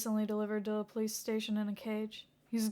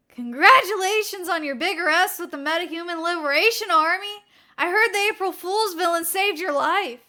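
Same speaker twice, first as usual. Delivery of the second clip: high, loud, bright congratulations; cheerful co-worker; 7 semitones higher, 11 dB louder than usual. Acted. Co-worker congratulating Naomasa, high and loud. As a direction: burst in all excited.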